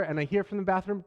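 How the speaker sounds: usual speaking voice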